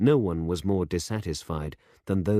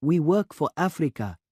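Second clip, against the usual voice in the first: put-on young demonstrator, proud; 10 semitones higher, 5 dB louder than usual. A narrator's reading. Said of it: "said the young man".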